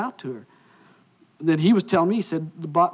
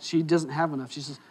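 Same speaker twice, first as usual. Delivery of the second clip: normal talking voice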